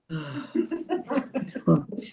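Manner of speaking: long, low exhausted sigh; weary arrival sigh. Shown as a demonstration. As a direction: act worn out with one heavy exhale. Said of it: "we do not want to come to Shabbat like this".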